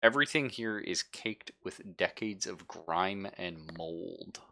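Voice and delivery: normal talking voice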